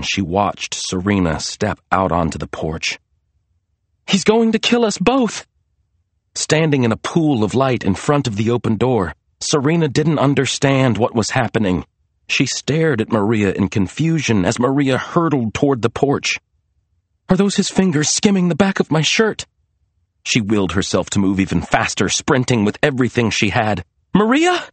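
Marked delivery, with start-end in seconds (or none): 4.1-5.5 high-pitched alarm; panicked. A narrator's reading. Maria fleeing Lester toward her sister, panicked. 17.3-19.4 frightened thought. A narrator's reading. Feels pursuer almost grabbing her. 24.1-24.6 high, questioning call. A narrator's reading. Serena calling to Maria running at her, calling out. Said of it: "Serena called out".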